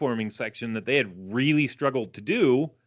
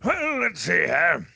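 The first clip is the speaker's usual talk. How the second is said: lightly voiced old announcer; slow, warm-up of an announcer voice; old-timey announcer; 5 semitones higher, slower than usual. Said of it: "do your old-timey announcer voice".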